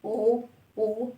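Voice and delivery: slow soft غو with rounded lips; teacher modelling غو softly; sounding out gho. Shown as a demonstration. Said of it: "هيبدأ بإيه؟".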